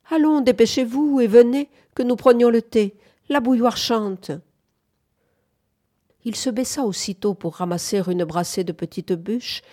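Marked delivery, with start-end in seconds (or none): 0.1-4.3 high, loud summons; calling, hurrying them. A narrator's reading. Bunford calling the pair in to tea, hurrying them. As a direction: hurry them indoors with a raised voice.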